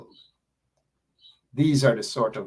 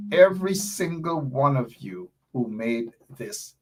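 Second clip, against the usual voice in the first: ordinary talk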